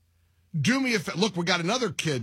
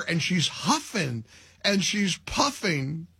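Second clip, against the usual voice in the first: usual speaking voice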